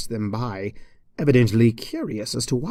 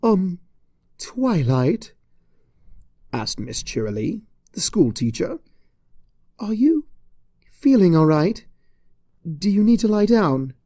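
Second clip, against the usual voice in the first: higher, louder concerned teacher; worried teacher checking on her; teacher Cheerilee asks if she's all right, higher; Cheerilee's voice; 6 semitones higher, 4 dB louder than usual. Acted.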